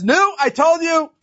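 high-pitched, drawn-out mock yell; retold scream. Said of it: "The older woman starts screaming at him".

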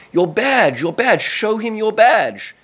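high, loud comic farmer's yell; calling out. Acted. Farmer calls to the agent fleeing his bull, mock-urgent shout. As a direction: call out urgently, pitched high, stretching the last words.

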